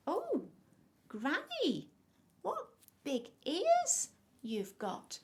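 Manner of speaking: high, loud, halting wonder; drawn-out storybook astonishment with pauses; amazed little girl. A narrator's reading. Girl marvels at false Granny.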